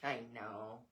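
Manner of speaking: ordinary delivery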